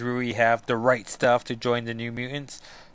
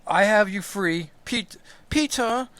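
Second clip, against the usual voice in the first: put-on child's voice from the armor; 10 semitones higher, 4 dB louder than usual. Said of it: "six-year-old Ileana inside the armor".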